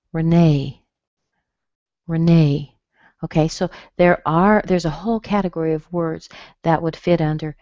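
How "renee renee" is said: "Renee" as ruh-NAY; weak uh, then stressed NAY in "Renee"; Renee with schwa. Shown as a demonstration. Teacher showing the first E, schwa in "Renee". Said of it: "you hear a schwa".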